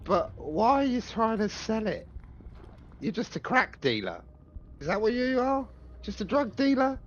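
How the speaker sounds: high-pitched, needling questions; mocking taunt. Adventurer taunts captor as a mere drug dealer, mocking. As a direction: belittle him with incredulous questions. Player taunts villain in character.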